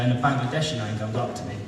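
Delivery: ordinary talk, nothing marked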